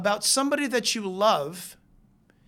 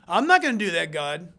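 louder, firm pushback, dropping at the end; mock refusal; 2 semitones lower, 4 dB louder than usual. Believer talking back to God, mock defiant refusal. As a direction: imitate someone flatly refusing an order. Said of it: "You can't say".

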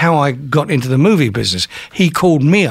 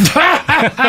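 sudden high laugh; high burst of laughter; 13 semitones higher than usual. Real, not acted. Laughs at own punchline.

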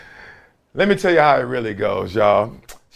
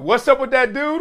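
higher hyped segment call; 10 semitones higher than usual. Acted. Host kicks off his opening segment, hyped title call. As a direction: announce the segment title with flair. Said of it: "We start off every show with".

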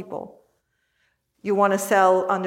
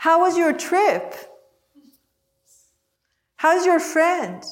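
higher, sweet-sounding probing questions; probing parent; 8 semitones higher than usual. Retold or imitated. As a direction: sound innocent but probe.

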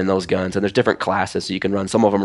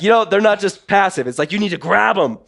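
high mock Russian accent; hammed-up Russian accent, urging; Sergei Luzov; 12 semitones higher, 4 dB louder than usual. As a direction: imitate an insistent Russian coach. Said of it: "I don't know if I can do a good Russian accent".

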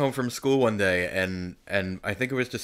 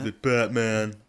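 mimicking a condescending question; mock a patronizing asker; slower than usual. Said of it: "I'm so condescending".

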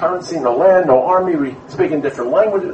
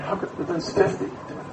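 put-on old man crying; 3 semitones higher, 9 dB quieter than usual.

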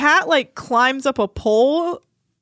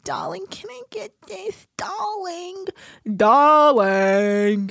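put-on posh accent; 5 semitones higher, slower than usual. Mocking a drunk cast member's fake posh accent. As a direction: stretch each darling like a tipsy socialite. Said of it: "What is with her accent?".

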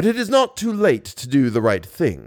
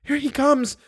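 very high-pitched heroine's cry; Christine crying out; 14 semitones higher than usual. A narrator's reading. Christine warns of his approach, high cry. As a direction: cry out in a much higher voice. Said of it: "cried Christine".

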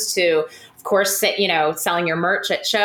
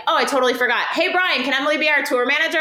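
higher-pitched imitation of an offhand call; casual calling out; 6 semitones higher, faster than usual. Bandmate asking Brian about a tour manager, casual call. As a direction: toss the question lightly to the next room.

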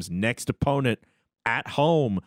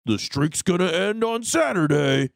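lightly mocking a hopeful fan, higher and slow; 7 semitones higher than usual. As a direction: imitate a fan's naive hope. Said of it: "they're like".

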